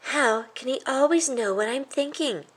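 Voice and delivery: a little mouse playing it cool after being caught; guilty young mouse. A narrator's reading. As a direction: boyish fake innocence. Chris wonders.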